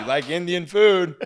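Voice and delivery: high, loud, laughing question. Real, not acted. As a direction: tease loudly through your laughter. Teasing the host, cracking up.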